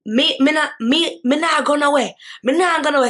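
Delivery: mock Jamaican refusal; Jamaican accent. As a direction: imitate her Jamaican defiance. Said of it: "She goes now".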